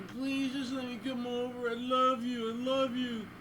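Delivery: high, pleading whine; mock pleading high whine; begging whiny voice. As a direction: beg like a lovesick loser. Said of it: "he was, like, begging".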